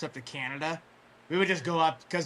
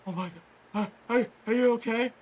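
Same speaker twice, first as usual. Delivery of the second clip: halting, higher-pitched imitation of alarm; mock shocked kid; 6 semitones higher, 4 dB louder, much faster than usual. Imitating thirteen-year-old walking out on the injury, halting shocked voice. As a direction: stammer it as a stunned kid, lightly acted.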